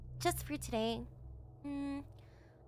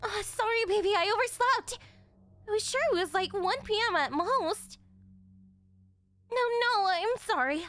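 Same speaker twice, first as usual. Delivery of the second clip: flustered apology; 6 semitones higher, 4 dB louder than usual. Acted. Girlfriend overslept, flustered apology.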